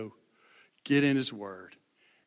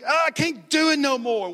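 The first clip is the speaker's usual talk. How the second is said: put-on much higher, louder wailing boy's cry; imitating bawling; 12 semitones higher, 10 dB louder than usual. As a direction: reenact the sobbing boy, wailing high. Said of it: "he was like".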